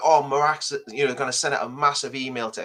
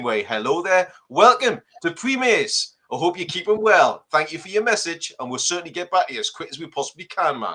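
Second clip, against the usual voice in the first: higher, hearty Geordie welcome; Geordie voicemail greeting; 4 semitones higher than usual. Shown as a demonstration. Newcastle man demonstrating a voicemail greeting, broad welcoming Geordie. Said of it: "I personally think a Geordie accent".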